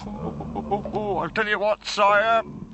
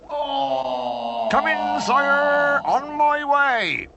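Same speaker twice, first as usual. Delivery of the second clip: calling out; 11 semitones higher, much slower than usual. Acted. Answers a summons from afar.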